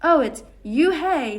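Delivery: Yoo-hae drawn out slowly as a tease; mocking mispronunciation. A narrator's reading. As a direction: twist the name into Yoo-hae, teasing. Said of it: "the boy said, laugh".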